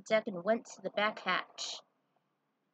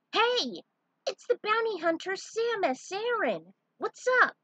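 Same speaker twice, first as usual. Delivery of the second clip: high, bright-voiced eager greeting; excited kid greeting; 12 semitones higher, 5 dB louder than usual. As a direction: greet her like a thrilled young fan.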